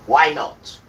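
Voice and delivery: sudden loud angry shout. Real, not acted. Ranting at the narrator on screen, loud angry shout. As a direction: bark it out at him.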